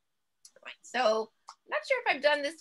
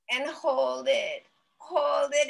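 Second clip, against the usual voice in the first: slow held breath cue; 3 semitones higher, much slower than usual. Shown as a demonstration. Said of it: "breathe in deeply".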